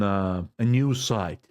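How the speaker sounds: normal talking voice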